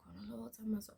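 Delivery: whispered echo; hushed disbelief. Retold or imitated. Girl in class echoing the bully's remark, hushed disbelief. Reacting under breath in quiet class.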